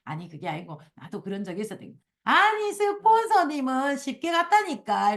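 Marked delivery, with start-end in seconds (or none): 2.2-5.1 high, slow, drawn-out mock complaint; mock stubborn protest. Member arguing with the sponsor, mock stubborn protest. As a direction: stretch out an imitated whiny objection. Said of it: "이렇게".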